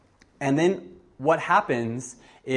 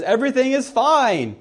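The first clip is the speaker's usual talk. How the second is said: much higher, louder joyful call; excited shout; 10 semitones higher, 7 dB louder than usual. Retold or imitated. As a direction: shout the good news while running. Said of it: "as he's running, he just says".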